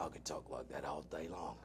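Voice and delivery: low, soft mock British gangster voice; Jason Statham impression. Doing impressions for his cohost, a low mock Statham. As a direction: drop way down into a quiet Cockney growl. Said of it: "How long can you talk like that?".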